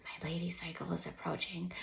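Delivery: soft whispered confiding; whispered mother confiding; hushed explanation. A narrator's reading. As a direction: let the children in on a secret. Mother explaining to her kids.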